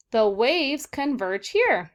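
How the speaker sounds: slow, deliberate read-aloud; teacher modeling a sentence, slow and clear. Shown as a demonstration. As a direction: read it out slowly and clearly for the kids. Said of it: "let's read our sentences".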